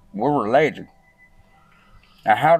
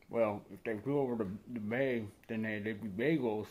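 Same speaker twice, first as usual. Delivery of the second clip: softer character host voice; a quiet kids-show host telling jokes; Jungle Jack; 12 dB quieter than usual. Acted.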